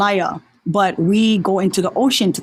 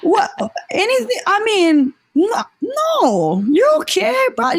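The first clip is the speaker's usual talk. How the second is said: pitched way up, soothing; high reassuring voice; 11 semitones higher than usual. Retold or imitated. To herself about the face tattoo, a high reassuring voice. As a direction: coax yourself high and bright, it's okay.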